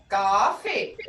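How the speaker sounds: usual speaking voice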